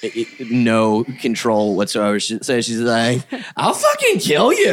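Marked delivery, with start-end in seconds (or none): 3.6-4.7 high-pitched unhinged threat, imitated; mock threat. Imitating drugged partner threatening a stranger, high mock rage. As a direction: squeal it out like someone with no control.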